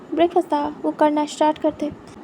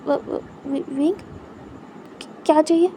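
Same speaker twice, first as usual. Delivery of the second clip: startled stammer; 4 dB quieter, much slower than usual. A narrator's reading. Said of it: "तुरंत stiff होता है".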